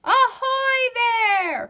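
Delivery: high, loud, slow hail from above; called out. A narrator's reading. Pirates hailing the captain from the branches, a loud drawn-out call. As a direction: hail the ship from up the tree. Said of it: "called a voice".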